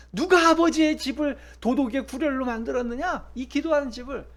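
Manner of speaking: Jesus rebuking the temple traders, loud and furious; angry rebuke. Acted. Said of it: "화를 냅니다".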